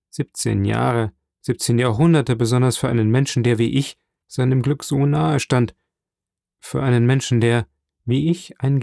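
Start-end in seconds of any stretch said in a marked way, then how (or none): none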